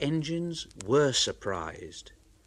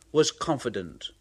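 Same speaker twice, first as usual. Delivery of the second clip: ordinary delivery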